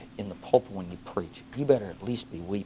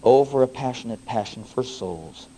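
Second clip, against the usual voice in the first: recited hymn; 8 dB louder than usual. Acted.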